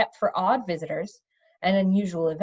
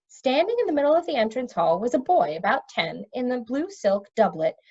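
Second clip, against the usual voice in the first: ordinary delivery, nothing marked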